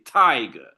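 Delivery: tiger with a strong ti- falling to a weak -ger; stress on first syllable. Shown as a demonstration. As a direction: model TI-ger for the class. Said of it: "Look how we pronounce the words".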